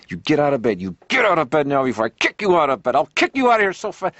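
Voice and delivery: high, loud mock-furious alarm voice; angry wake-up threat. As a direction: bark the wake-up threat, building. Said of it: "a human voice that maybe says".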